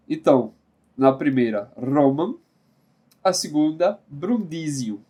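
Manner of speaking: ordinary talk, nothing marked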